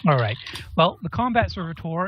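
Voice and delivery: normal talking voice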